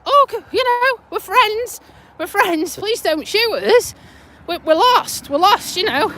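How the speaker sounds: high-pitched, mildly panicked retold plea; mock pleading. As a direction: imitate begging armed troops for mercy. Acted plea to armed troops.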